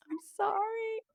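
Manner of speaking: tiny high, soft squeak; sheepish mock apology. Mom imagines apologizing to religious family, sheepish squeak.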